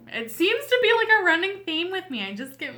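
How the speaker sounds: sheepish self-mocking giggle; embarrassed laugh. Real, not acted. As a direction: laugh off your own tears. Embarrassed about being weepy.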